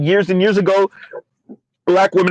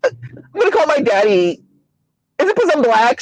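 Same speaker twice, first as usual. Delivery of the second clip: mock Valley Girl, higher pitch; 7 semitones higher than usual. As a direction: imitate a fake Valley Girl whine. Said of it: "Fake Valley Girl voice".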